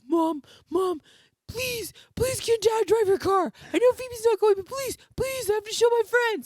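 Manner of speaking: put-on high whiny pleading kid; begging kid voice. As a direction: beg like an eager kid. Mom voicing begging son.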